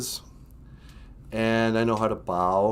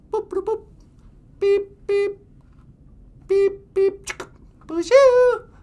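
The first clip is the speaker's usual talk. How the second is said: high electronic beeping; high, loud beep-beep teleporter sounds; robot beeps; 7 dB louder, slower than usual. Pretend. Teleport beeps, puppet greeting.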